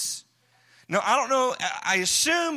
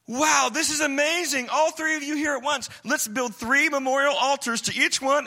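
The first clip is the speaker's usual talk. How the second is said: gushing Peter; 7 semitones higher than usual. Acted. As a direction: blurt out your excitement.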